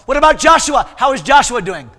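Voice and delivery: loud, high, exuberant shouted question; Brother Vic. Retold or imitated. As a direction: boom out the friendly question. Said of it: "that's the way he talked".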